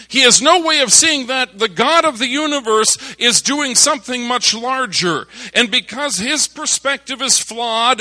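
rising sermon crescendo; preaching at full force. Acted. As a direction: preach it, climbing to a roar.

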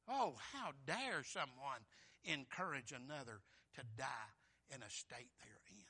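ordinary talk

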